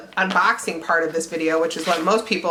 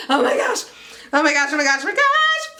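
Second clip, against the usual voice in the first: high repeated squeals; can't contain it, squeal it out; excited squeal; 5 semitones higher than usual. Real, not acted. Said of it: "I'm freaking out".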